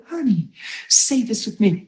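high, softened coaxing voice; smiley and soft. Retold or imitated. Minister coaxing a hurting woman, smiley and soft. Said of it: "I'm just smiley and soft".

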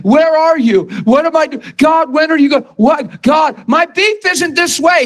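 high, anxious pleading in a mock voice; whiny undecisive believer. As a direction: play the lost believer crying out to God. Mimics an undecisive, panicked believer.

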